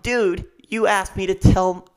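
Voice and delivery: high, loud, drawn-out mock protest; stretched-out indignant disbelief; indignant retort. Indignant retort to rude interviewer.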